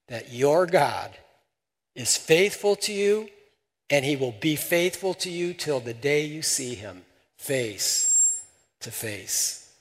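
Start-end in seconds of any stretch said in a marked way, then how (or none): none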